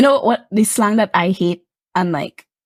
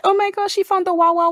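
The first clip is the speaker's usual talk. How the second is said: higher mock-excited gossip voice; mock headline; 9 semitones higher than usual. Retelling a silly headline, mock excitement. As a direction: ham up the headline. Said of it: "It's like".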